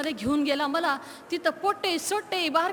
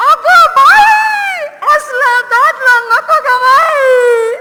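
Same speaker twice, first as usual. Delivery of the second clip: loud, drawn-out crying wail, much higher; wailing howl; 8 semitones higher, 14 dB louder, much slower than usual. Acted. Little girl wails to mother about her tooth, howling. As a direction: bawl it out like a child in despair. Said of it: "फोडलं ना भोकांड आणि लागले ना रडू".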